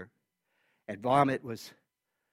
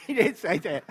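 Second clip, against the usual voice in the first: laughing it off, a bit higher and louder; 7 semitones higher, 6 dB louder than usual. Real, not acted. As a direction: giggle through 'She didn't say that'. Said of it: "I'm just kidding".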